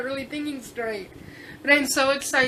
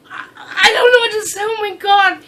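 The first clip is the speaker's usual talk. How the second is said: higher, louder, breaking into tears; happy tears spilling out; real sobbing joy, voice cracking; overwhelmed crying; 7 semitones higher, 8 dB louder than usual. Real, not acted. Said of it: "I could cry".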